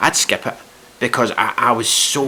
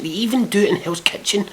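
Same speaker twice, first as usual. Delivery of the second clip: high-pitched incredulous aside; incredulous rant; 10 semitones higher than usual. Real, not acted. Ranting about reality shows, incredulous. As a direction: say it high, at ordinary volume. Ranting, first take of repeated line.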